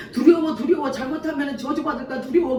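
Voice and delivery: mock fearful Jacob dreading a curse; fearful worry. Jacob voiced fearing a curse.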